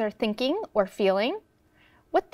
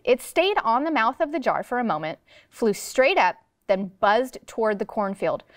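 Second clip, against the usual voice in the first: ordinary delivery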